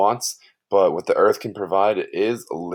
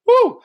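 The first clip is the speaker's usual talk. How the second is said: excited whoop; 8 dB louder than usual. Real, not acted. Cheers after explaining concept.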